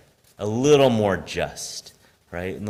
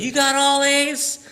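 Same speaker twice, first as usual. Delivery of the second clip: much higher, slow amazed question; 14 semitones higher, slower than usual. Retold or imitated. Store owner amazed at a kid's grades. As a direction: marvel at the report card. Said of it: "he goes".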